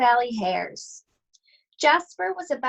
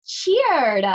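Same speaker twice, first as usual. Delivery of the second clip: cheering; 6 semitones higher, 6 dB louder than usual. A narrator's reading. Carrots cheer.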